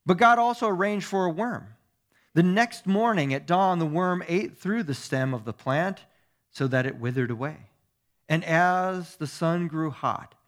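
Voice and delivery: loud, dramatic Bible narration, easing at phrase ends; scripture reading. Acted. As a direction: proclaim the story to a congregation. Reads scripture aloud.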